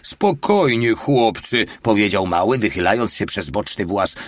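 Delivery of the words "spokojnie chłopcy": high, louder call to calm them. Acted. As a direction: raise the voice over the crew. Mały calms the painted men.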